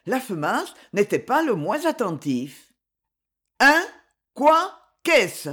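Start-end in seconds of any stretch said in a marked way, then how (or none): 3.6-5.5 short, raised cries of alarm; shouted questions. A narrator's reading. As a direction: fire off startled questions, voice up. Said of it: "cria Lureau en accourant". (questions criées, voix haute)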